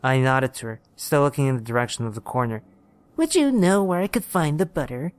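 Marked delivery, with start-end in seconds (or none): 3.2-5.1 put-on high-pitched old woman's question; old lady. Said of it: "she asked me".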